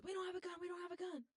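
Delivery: high-pitched mock scream, held back in volume. Retelling own panic about a break-in, half-voiced mock scream. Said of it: "I was screaming at the top of my lungs".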